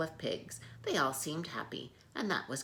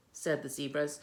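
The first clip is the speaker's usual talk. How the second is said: plain reading voice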